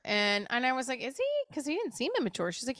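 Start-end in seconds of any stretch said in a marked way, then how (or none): 1.0-1.5 high, soft incredulous Is he; asking the medium in disbelief, high and soft; surprised. Retold or imitated.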